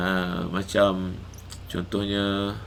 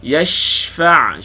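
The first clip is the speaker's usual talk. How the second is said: higher, louder Quranic chant, shin drawn out; Quran recitation; 6 semitones higher, 7 dB louder, much slower than usual. Shown as a demonstration. Teacher reciting a verse for students to follow, Quran chant. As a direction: recite yashfa' stretching the shin. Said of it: "Shin sukun. Panjangkan bunyi shin".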